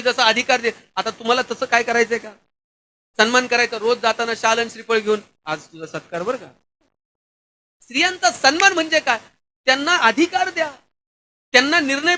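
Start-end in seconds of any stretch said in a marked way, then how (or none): 5.4-6.5 a softer, teasing mock honour; mock ceremony. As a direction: tease the idea gently as if bowing. Mocking daily shawl-and-coconut honour.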